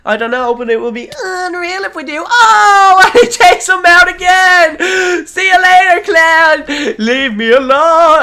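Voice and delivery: high, loud laughing taunts; gleeful shouting. Real, not acted. Gamer jeering the beaten rival clown, loud and gleeful. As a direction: crow over the beaten rival.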